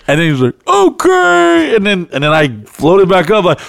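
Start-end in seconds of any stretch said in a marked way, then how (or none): 0.7-1.8 mock Henry reply. Henry answering Frank.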